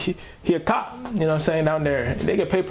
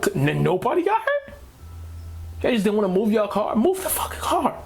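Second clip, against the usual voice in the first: higher, exasperated mock outburst; fed-up rant; 5 semitones higher than usual. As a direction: vent at drivers blocking the road. Ranting about stalled accident traffic.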